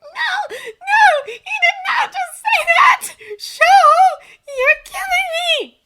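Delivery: very high, loud wail through laughter; shrieking, helpless disbelief; hysterical wailing. A narrator's reading. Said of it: "she wailed".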